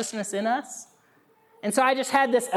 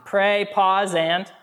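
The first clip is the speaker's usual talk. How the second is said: ordinary delivery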